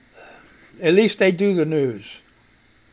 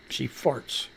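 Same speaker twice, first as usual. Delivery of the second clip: hushed reveal; 7 semitones lower, 13 dB quieter than usual. Real, not acted. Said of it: "Oh, my God".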